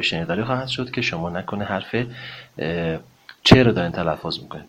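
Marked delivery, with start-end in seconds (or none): none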